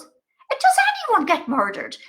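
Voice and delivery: put-on high, eager question in an old mother's voice; high mammy voice. Her mother pressing about murders, high and eager. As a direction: press on hopefully like an old mum. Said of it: "And she goes".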